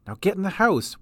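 commanding but level; plain stern instruction; firm order. Acted. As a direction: tell them to go inside. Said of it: "said Fantabulo".

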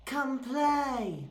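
put-on soft, drawn-out ghostly child call; creepy kid. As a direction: lure Oscar into playing, eerily. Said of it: "when a creepy kid wants to play".

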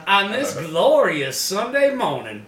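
loud, high, drawn-out preacher voice; hammed-up revival greeting; mock preacher. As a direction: preach it to a Sunday congregation. Plays preacher greeting the congregation.

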